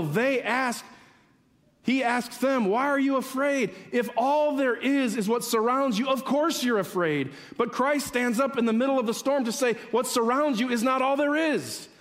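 high, driving sermon climax; impassioned preaching. Real, not acted. Preaching at full force.

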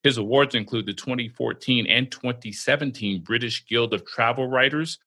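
usual speaking voice

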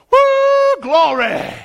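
high, loud whoop of joy; excited church shout. Retold or imitated. Old church lady whooping in the Spirit, loud and high. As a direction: shout it out like a sister catching the Spirit. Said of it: "she would go".

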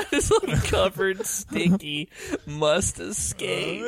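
slow, drawn-out mock recital; slow dramatic reading. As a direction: stretch each word out theatrically. Rereads haiku line slowly.

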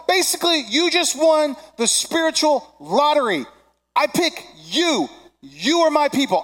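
high, loud, emphatic shouted preaching; impassioned proclamation. Acted. God choosing slaves in Egypt, proclaimed with fervor. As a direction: proclaim it at full force, stretching the key words. Preacher voices God's promises at full force.

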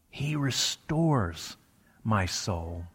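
slow, soft, with restores drawn out; stress on restores. Shown as a demonstration. Preacher repeating the passage, restores stressed and drawn out. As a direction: stretch restores, then let the rest drop softly. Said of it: "emphasize different parts of it separately".

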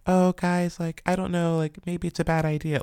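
high, timid mock voice of a doubtful crew member; mock hesitant colleague. Imitating a crew member who timidly objects, high and unsure. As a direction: pitch it up like a nervous coworker speaking up. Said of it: "who was like".

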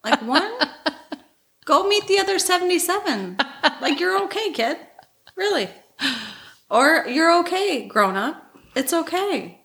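high, half-joking fatherly lecture; mock dad voice. Podcast host voicing her dad to listeners, high-pitched and teasing. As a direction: play her dad shrugging off a breakup.

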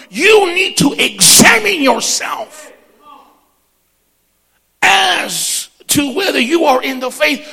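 loud, high preacher shout building to a peak; shouted sermon climax; full-force preaching. Acted. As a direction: thunder it at the congregation.

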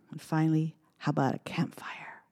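hushed whisper. Acted. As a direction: whisper it like a secret. Whispered lead-in to verse.

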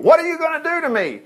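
high, frightened demon shriek. Acted. Demon shrieking in fear at Jesus. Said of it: "It gave a terrible scream, shrieking".

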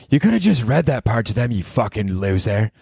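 mock him as girlfriend.

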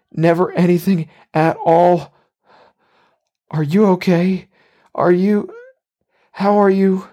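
put-on high, boyish, distraught apology; high-pitched anxious boy. A narrator's reading. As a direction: plead in a high voice like a frightened boy. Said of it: "sounding and looking like a boy again".

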